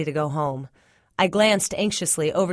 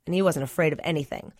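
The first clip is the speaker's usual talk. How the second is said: usual speaking voice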